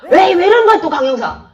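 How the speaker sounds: loud, high 이러는 with a falling end; alarmed colleague. Retold or imitated. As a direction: stop a partner's outburst in alarm. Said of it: "옆에 다른 형사가".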